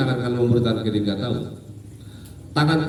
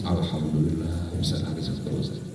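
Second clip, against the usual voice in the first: imitated tearful relief; 7 semitones lower, 12 dB quieter than usual. Pilgrim to ustadz after finally crying, imitated tearful murmur. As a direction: breathe out thanks quietly, as if weeping. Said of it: "dia nangis".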